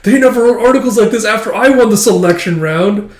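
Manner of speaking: high, loud indignant complaint; acted angry outburst over being overlooked; mad outburst. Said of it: "like, getting mad".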